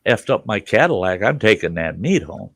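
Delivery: usual speaking voice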